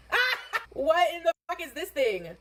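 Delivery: high, laughing disgusted cry; shocked laughing shriek. Real, not acted. Shocked at the sight.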